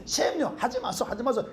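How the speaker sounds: schoolboy begging in Gyeongsang dialect; Gyeongsang dialect plea. Retold or imitated. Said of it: "경상도 말로".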